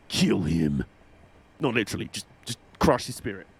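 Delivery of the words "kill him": very low villainous growl of a command; mock-sinister team boss; menacing order. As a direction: drop deep like a sinister boss.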